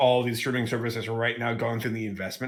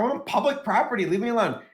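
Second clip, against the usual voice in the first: indignant protester; 7 semitones higher than usual. Retold or imitated. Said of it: "the protester's like".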